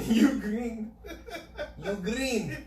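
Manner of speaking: "green" with an unnecessary rolled R, pitched high. Retold or imitated. Echoing a friend's accent joke, rolling the R in "green". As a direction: roll the R in "green" for comic effect. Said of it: "With an unnecessary R roll".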